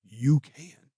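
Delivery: quietly imitating Paul Heyman's dead-serious words. Paul Heyman telling Jelly backstage only he can do it, softly serious. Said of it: "He looks at me so serious".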